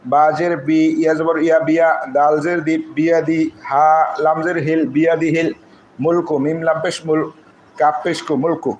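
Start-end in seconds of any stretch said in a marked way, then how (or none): none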